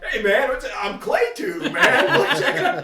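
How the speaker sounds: high, loud, eager show-off; proud kid voice. Retold or imitated. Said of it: "and I'm like".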